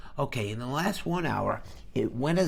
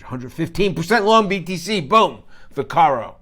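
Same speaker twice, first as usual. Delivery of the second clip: loud, higher-pitched burst; excited shout-out; 4 semitones higher, 12 dB louder than usual. Real, not acted. Streamer reading a viewer's winning trade from chat, excited shout-out. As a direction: punch it out with a big boom.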